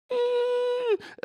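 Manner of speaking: sharp high gasp of shock. Retold or imitated. Reaction to strange question.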